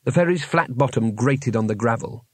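ordinary delivery, nothing marked

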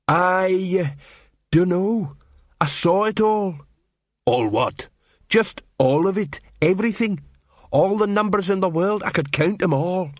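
a troll dazed by a vision; awed troll voice. Acted. Troll awed, much higher.

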